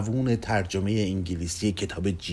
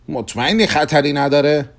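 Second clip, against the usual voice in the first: higher-pitched, mildly anxious check; worried question; 5 semitones higher, 5 dB louder than usual. Acted. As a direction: ask it with rising worry. Customer worries about the danger.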